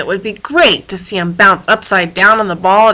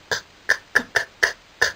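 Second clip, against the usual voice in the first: soft voiceless k clicks; clanking keys; 7 dB quieter, much faster than usual. Acted. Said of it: "heard something clanking".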